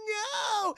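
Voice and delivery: high wailing no; mock terrified cry. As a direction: cry out as if hanging on for life. Imitates dangling woman's cry.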